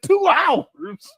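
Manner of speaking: high, loud incredulous shout; shocked. Retold or imitated. Vlogger retelling his shock at an ad, disbelieving shout. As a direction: yell it like you can't believe it.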